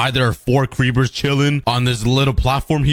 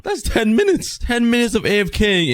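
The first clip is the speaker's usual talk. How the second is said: much higher, thrilled announcement; excited; 10 semitones higher than usual. Real, not acted. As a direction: shout the result like you won big. Excited reveal of loot.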